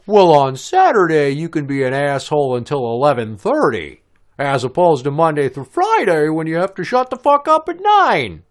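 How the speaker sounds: loud, high-pitched comic character voice; exaggerated, rising official pronouncement; Don Knotts. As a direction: proclaim it in a strained, cartoonish high voice. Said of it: "Don Knotts is apparently the neighbor".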